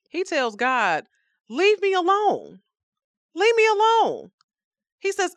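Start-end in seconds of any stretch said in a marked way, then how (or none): 1.5-4.3 much higher, loud strained cry; wailing leave me alone at God; angry plea. Acted. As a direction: push him away with your voice. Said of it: "I'm angry".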